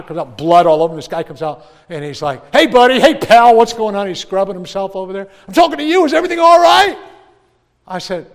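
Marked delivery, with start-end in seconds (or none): 2.5-7.0 higher, loud hearty calling out; loud chummy doctor; brash doctor. Retold or imitated. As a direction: call across the room, all bluster. Bloody doctor calling to patient.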